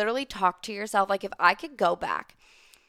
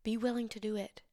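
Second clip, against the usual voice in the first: normal talking voice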